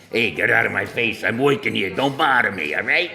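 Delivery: a Brooklyn wise guy brushing you off; tough Brooklyn plumber. Retold or imitated. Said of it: "Italian plumber from Brooklyn".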